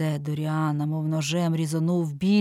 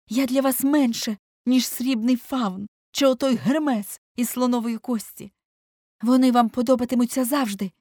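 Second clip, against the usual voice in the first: high, loud, bitter reproach; seething irritation; 7 semitones higher, 5 dB louder than usual. A narrator's reading. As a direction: keep accusing him, voice raised with hurt. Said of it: "мало не кипів від роздратування". (гірко й роздратовано, підвищеним голосом)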